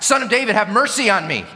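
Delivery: high, urgent plea for mercy; crying out. Acted. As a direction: cry out from the soul, pitched high. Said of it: "He begins to cry out".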